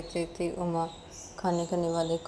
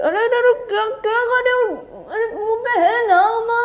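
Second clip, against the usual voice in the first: very high, loud wail; acted wailing protest; 11 dB louder than usual. Character handed a second mouthful by partner objects, wailing. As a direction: squeal in hammed-up alarm.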